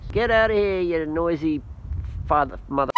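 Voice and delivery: high playful yell at the birds; shooing birds. Real, not acted. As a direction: shoo the birds off. Shooing away noisy birds.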